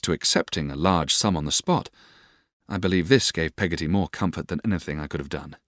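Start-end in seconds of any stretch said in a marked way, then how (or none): none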